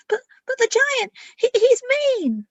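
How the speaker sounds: high, loud stammering fear; frightened plea. Acted. Scared dragons pleading with Adana about the giant, high and stammering.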